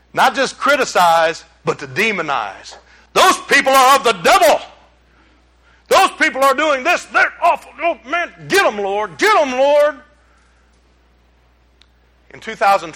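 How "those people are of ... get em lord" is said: mocking self-righteous churchgoer. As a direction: ham up the holier-than-thou prayer against enemies. Mocking church folk who demonize.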